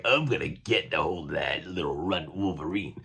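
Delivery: lightly voiced angry monster hero; hint of a grumbling Thing; gruff Thing voice. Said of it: "But he's like".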